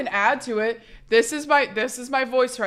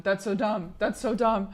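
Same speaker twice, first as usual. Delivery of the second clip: usual speaking voice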